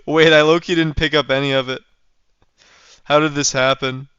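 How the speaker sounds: normal talking voice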